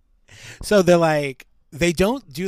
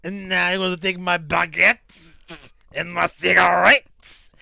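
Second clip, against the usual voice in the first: louder, hammed-up French accent; a smug Frenchman with baguettes, French accent; cartoonish French accent; mock French accent; 3 semitones higher, 4 dB louder than usual. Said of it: "Giant Frenchman".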